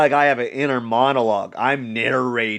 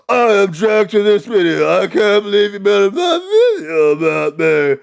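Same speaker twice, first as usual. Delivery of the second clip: higher, louder mock whine; whiny Logan Paul; 8 semitones higher, 7 dB louder than usual. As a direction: whine like you're wounded. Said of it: "Logan Paul responds".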